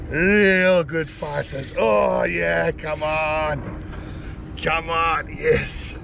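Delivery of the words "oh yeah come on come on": ordinary delivery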